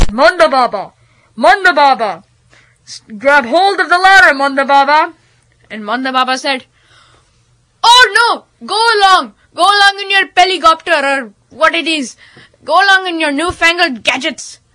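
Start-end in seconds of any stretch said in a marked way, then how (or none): 0.1-5.1 loud, projected call. Acted. Man on helicopter rope ladder calling down, shouting. 7.8-14.6 high, emphatic dismissal; indignant holy man. Acted. Holy man sending the helicopter away, indignant.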